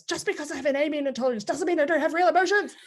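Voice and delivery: high, loud, fast indignant yell. Retold or imitated. Family member yelling at another in a mock-indignant high rant. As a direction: fire it off in a hammed-up outburst. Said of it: "somebody will yell at somebody else".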